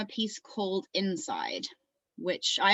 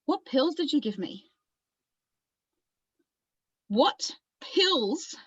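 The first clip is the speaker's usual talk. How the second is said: stunned demand; 6 semitones higher, slower than usual. Acted. Acted demand after sudden realization.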